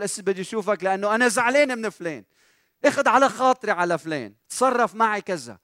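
high, loud mock grievance; aggrieved complaint. Imagined church member phones the pastor about someone, aggrieved complaint. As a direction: complain like an offended caller. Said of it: "على طول".

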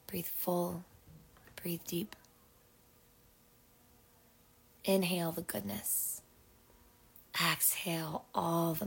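unhurried meditation voice with long pauses; guided meditation. Acted. Host settles listeners in to breathe and rest, guided meditation.

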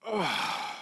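long, soft, low sigh from the soul; deep sigh. Shown as a demonstration. Preacher demonstrating to the congregation, deep sigh. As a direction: let all the air out heavily. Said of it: "a deep sigh from the soul".

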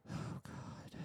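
barely audible, voiceless groan of words; whispered exasperation. Acted. Passenger offered a flight to Dallas, whispered exasperation. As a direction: whisper it to yourself in dismay.

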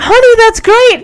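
high-pitched, gushing congratulations; mock delight. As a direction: imitate a delighted husband praising his wife. Husband congratulates wife in retelling.

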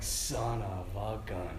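low, slow, hushed wonder; quiet amazement. Retold or imitated. Priest seeing the man wink at him, quietly amazed. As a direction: let the realization sink in slowly, very soft. Stunned realization after the wink.

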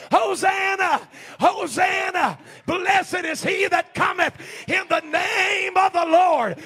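high, slow, drawn-out acclamation; shouting with a loud voice. Acted. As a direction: cry it out like a welcoming crowd. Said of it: "they were shouting it with a loud voice".

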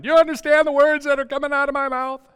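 high, loud, fast exasperated yell; indignant movie-cop shout; Chris Tucker. Retold or imitated. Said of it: "this picture of Chris Tucker in my mouth going".

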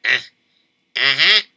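ordinary delivery

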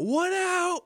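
high mock yell across the field; high teasing shout; retold yell. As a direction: yell it out to taunt the batter. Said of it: "he yells out".

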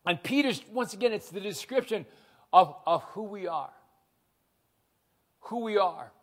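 ordinary talk

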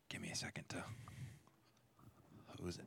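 soft whisper to herself; teacher murmuring an aside while wiping the board. Real, not acted. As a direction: breathe it out under her breath.

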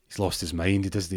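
ordinary delivery

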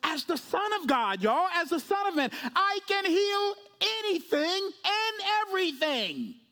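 high, drawn-out preaching climax; triumphant proclamation. Acted. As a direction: proclaim it slowly with rising pulpit fervor. Proclaims as Jesus at full force.

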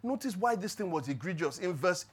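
ordinary talk, nothing marked

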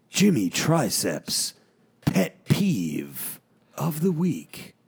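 slow, drawn-out announcer intro; grand entrance announcement. Pretend. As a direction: build it like a ring announcer. Introduces the segment.

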